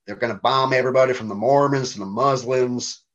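imitated Southern American accent; American taxi driver. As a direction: quote a cabbie in a mock-Southern voice. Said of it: "a taxi driver said that to me".

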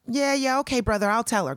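high, placating brush-off; retold dismissive humoring. Sister to brother about jasmine idea, humoring him.

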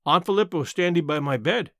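usual speaking voice

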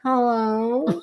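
high, drawn-out cat-style hello; meow-like hello. Shown as a demonstration. Showing how the cat's greeting sounded, meowed hello. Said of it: "a meow that sounds like a hello".

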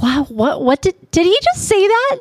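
rising high in disbelief; can't believe what she heard; stunned disbelief. Retold or imitated. Hearing the son call her Mom, stunned high disbelief. Retold shock at being called Mom.

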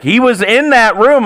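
high, loud mock protest; imitated heated insistence; insistent. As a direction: argue back like an aggrieved customer. Acts the women insisting back.

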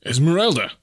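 high, loud shout of alarm; shrieked name. A narrator's reading. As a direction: shriek it to rouse someone who has fainted. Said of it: "he shrieked in her ear".

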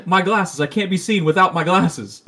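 retelling the cartoon, a loud hammed-up Johnny Bravo. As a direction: ham up Johnny Bravo's panic. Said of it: "Johnny Bravo's like".

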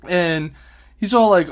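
ordinary delivery

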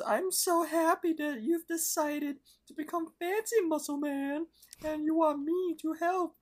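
very high falsetto cartoon voice; Pops. Delighted friend offering to help him become fancy, piping falsetto. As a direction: put on a delighted squeaky character voice, way up high. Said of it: "Pops laughs and says".

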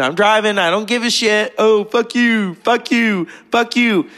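high-pitched mock rant; nutty angry friend. Friend texting while driving, acting nuts and angry. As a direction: imitate a friend ranting and cursing. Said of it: "He's nuts".

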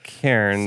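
"Cairns" with the R sounded, like CARE-nz; "Cairns" said CARE-nz, R fully there; straight-up Cairns. Shown as a demonstration. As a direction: say "Cairns" as spelt, keeping the R. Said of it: "that was just straight up".

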